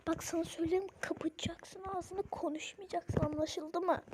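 whispered stern order; warning whisper. Acted. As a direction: hush her with a hard whisper. Mother whispers warning before police.

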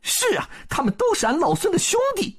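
very high, quick Monkey King voice; 孙悟空 confirming. A narrator's reading. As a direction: answer fast in a high monkey-hero pitch. (尖高轻快的孙悟空)

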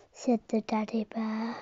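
ordinary delivery, nothing marked

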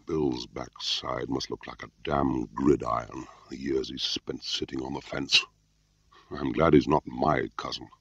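wry muttered aside. A narrator's reading. Control's wry remark, voiced in a story.

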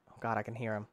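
normal talking voice

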